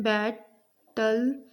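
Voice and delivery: "Battle" slowly parted as बै, टल; split into syllables. Shown as a demonstration. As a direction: say "battle" in two beats with a pause between. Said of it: "ल की जो sound आ रही है".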